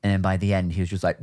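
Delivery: usual speaking voice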